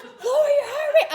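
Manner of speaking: high, laughing imitation of an order; mock doctor order. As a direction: mimic the doctor while laughing. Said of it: "He's like".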